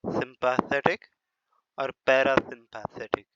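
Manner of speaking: slow, careful सिंपैथेटिक with a rising end; careful pronunciation. Shown as a demonstration. Teacher showing students how to read the terms. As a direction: say पैरासिम्पैथेटिक slowly, each syllable clear. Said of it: "आपने इसको कैसे पढ़ना है?".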